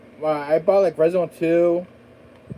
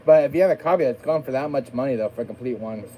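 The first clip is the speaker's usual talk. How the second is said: usual speaking voice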